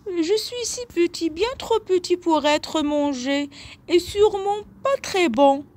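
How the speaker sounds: petite voix haut perchée qui plaide; négocier en petit bouc, très aigu; petit bouc suppliant, voix aiguë. A narrator's reading. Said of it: "de sa voix aiguë". (voix aiguë et suppliante de chevreau)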